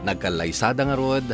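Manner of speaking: ordinary talk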